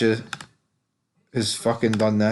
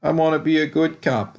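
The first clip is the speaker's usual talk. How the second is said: higher, pleading mock whine; mock her plea to be good; a lightly voiced pleading cop; whiny Angela mimic; 5 semitones higher than usual. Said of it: "she's like".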